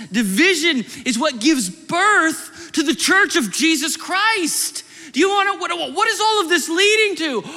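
loud, high, impassioned preaching; preaching at full force. Real, not acted. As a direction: cry it out to the whole congregation.

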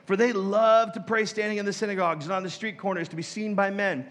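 solemn Bible reading voice; scripture reading. Acted. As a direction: read the verse out like from the pulpit. Reads scripture aloud.

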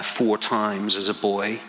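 ordinary talk, nothing marked

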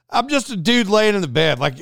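ordinary talk

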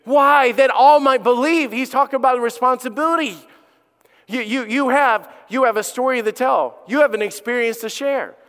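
loud, high preaching; full-force preaching. Acted. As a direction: drive the point home to the congregation. Preaching to congregation.

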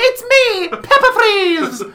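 very high, loud, drawn-out fairy voice; squeaky fairy voice. Pretend. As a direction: shriek it like a cartoon fairy. Voicing the fairy Pepper Freeze.

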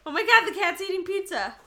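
startled and laughing; surprised laughing. Real, not acted. Cat caught stealing pizza.

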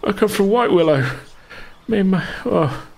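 high, pleading bandit voice, breaking into desperation; begging wounded bandit. Acted. Wounded bandit pleading with Ruby for his life. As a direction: beg for your life, terrified and hurting.

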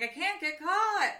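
high, loud mock panic; hammed-up frantic worry; acted freaking out. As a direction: stretch it out in slow pretend distress. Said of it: "she's, like, freaking out".

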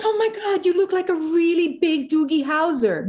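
high, gushing retold gasp of awe; starstruck exclamation. Actress retelling meeting her hero, starstruck and high. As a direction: replay the thrill of spotting a star.

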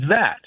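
"that" lifted high and set apart; stress on that. Shown as a demonstration. Caller showing the host which word she stresses, emphatic. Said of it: "I would put emphasis on the word".

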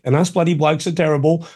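normal talking voice

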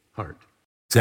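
ordinary talk, nothing marked

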